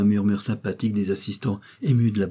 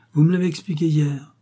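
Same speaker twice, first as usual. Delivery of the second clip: lightly voiced tired child begging to be spared; a hint of a weary boy asking for mercy; weary plea; 6 semitones higher, 4 dB louder than usual. A narrator's reading. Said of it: "d'un air fatigué qui demandait grâce". (avec une légère lassitude d'enfant)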